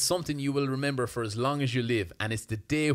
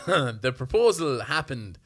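laughing; 5 dB louder, slower than usual. Real, not acted. Telling proposal story, amused.

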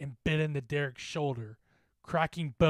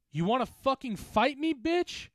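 high, raised-voice insults; shouted taunt; 7 semitones higher, 5 dB louder than usual. Acted. Bully holding a teammate in a headlock, shouting. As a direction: hurl the insults loudly at him. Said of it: "he shouted at me".

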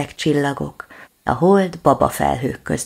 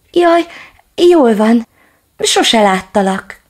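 relieved cry; 8 semitones higher, 8 dB louder, slower than usual. Acted. Little dog relieved.

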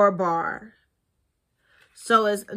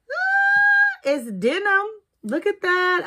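excited squeal; 10 semitones higher, slower than usual. Real, not acted. Unboxing the denim lipstick case.

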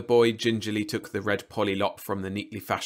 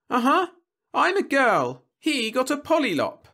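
put-on very high, loud child voice; high-pitched girl's complaint; indignant little girl; 7 dB louder than usual. A narrator's reading. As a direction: protest like a small kid. Girl correcting the robot.

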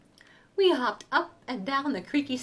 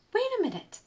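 excited realization; 10 semitones higher, faster than usual. Acted. Clever dog has an idea.

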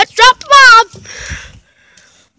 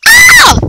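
piercing, very high loud shriek; play scream; 14 semitones higher, 6 dB louder than usual. Pretend. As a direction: shriek in pretend terror.

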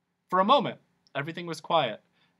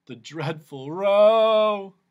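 louder, very slow lament; mock anguished cry; 6 semitones higher, 10 dB louder, much slower than usual. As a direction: wail over the name. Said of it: "cried the anguished Din".

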